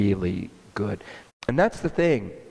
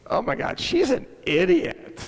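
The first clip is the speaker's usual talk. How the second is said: much higher, scornful disbelief; dismissive inner thought; 13 semitones higher than usual. Retold or imitated. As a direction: scoff at her silently.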